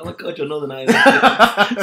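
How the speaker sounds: loud, high, drawn-out mock astonishment; mock amazement. Teasing a friend about their accent, mock amazed. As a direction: gush loudly as if just discovering it.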